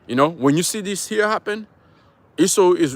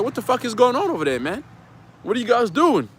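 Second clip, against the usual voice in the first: incredulous outburst; 7 semitones higher than usual. Retold or imitated. Mock outraged question to the storm-makers.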